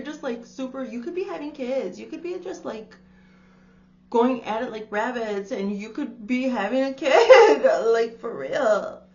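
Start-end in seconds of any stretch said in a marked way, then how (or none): 5.5-9.0 loud laughing through the words. Real, not acted. Reader joking to viewer about having kids, laughing loudly. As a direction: crack up mid-sentence.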